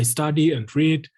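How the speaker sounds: normal talking voice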